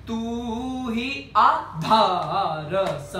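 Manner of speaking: तू ही आधार drawn out slowly, धा stressed; showing the rhythmic entry. Shown as a demonstration. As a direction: stretch Aadhaar and lean on धा. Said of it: "यूं उठती है". (आधार में धा पर ज़ोर, धीरे)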